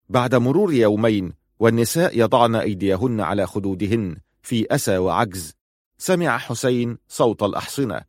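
plain reading voice

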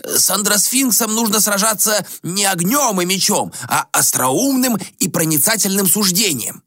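a lightly voiced reproachful sage; old ascetic. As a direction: scold the prince like a worried elder. Gomon explains.

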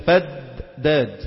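فداد very slowly, split after a held first د; first dal doubled. Shown as a demonstration. Teacher showing which dal is doubled, first د of فداد held long. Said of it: "أولاهما مشددة".

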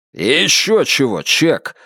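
drawn-out scornful objection; mildly outraged refusal; indignant. A narrator's reading. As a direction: scoff in indignation. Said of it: "возмутился Стив Бернхард".

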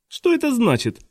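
high-pitched puzzled man's question; мистер По. A narrator's reading. Newcomer interrupts from the doorway, high puzzled voice.

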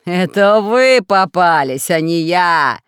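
slow, loud, drawn-out taunt; gloating villain. A narrator's reading. Hidden ruler mocks trapped friends, gloating villain voice. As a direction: turn the tables, stretching each word. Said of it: "захохотал голос".

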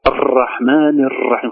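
الرحمن الرحيم with a repeatedly trilled R; over-rolled R. Shown as a demonstration. Teacher imitating children's mistake, R trilled over and over. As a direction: roll each R in الرحمن الرحيم too many times. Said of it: "تخرج متكررة بكسر".

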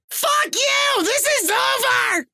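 very high hysterical screech; crying and screaming. Acted. Girlfriend shutting off the computer on a gamer, crying and screaming. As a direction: scream it in falsetto, close to tears. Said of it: "G F is crying and screaming".

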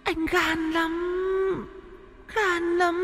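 high, soft, slow ghostly wail; a dead girl calling her lover from beyond. Acted. Ghost girl luring Huyễn, high eerie soft wail. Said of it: "âm thanh chồng đục".

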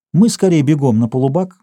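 plain reading voice

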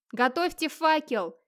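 moderate, high-pitched, drawn-out call. A narrator's reading. Скёльд calls from the pit to his brothers, shouting upward. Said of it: "крикнул Скёльд наверх".

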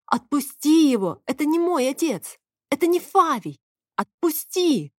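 alarmed, high-pitched protest; urgent shouting. A narrator's reading. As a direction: plead loudly to make her let go. Shouting to stop the fight.

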